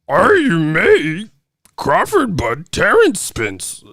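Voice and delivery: high, drawn-out mock creature voice; Yoda. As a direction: read it slowly like a baffled alien sage. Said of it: "Dyslexic Yoda sounded that".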